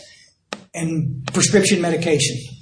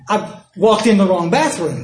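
usual speaking voice

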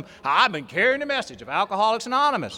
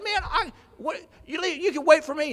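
begging; 10 semitones higher than usual. Retold or imitated. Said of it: "So I started begging".

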